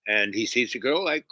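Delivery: ordinary delivery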